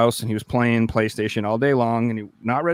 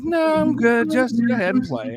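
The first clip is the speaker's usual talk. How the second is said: teasing stepbrother; 11 semitones higher, 3 dB louder than usual. Retold or imitated.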